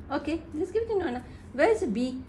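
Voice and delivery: high, sing-song coaxing. Real, not acted. Mother coaxing baby to hand it over, baby talk.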